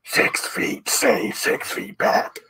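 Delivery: slow breathy voice behind a mask; Darth Vader. Retold or imitated. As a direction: warn them off through a gas mask. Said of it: "we were like Darth Vader".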